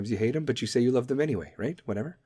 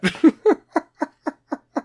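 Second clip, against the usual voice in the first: high laugh; 6 dB louder than usual. Real, not acted. Laughs at own joke.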